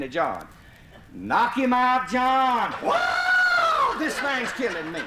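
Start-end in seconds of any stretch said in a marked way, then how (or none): 1.1-2.7 calling up the tree. Retold or imitated. 2.7-5.0 high shrieked whoa, then a laughing wail; squalling in pain. Retold or imitated. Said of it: "You could hear John squall".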